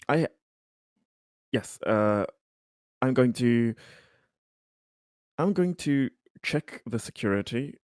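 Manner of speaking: ordinary talk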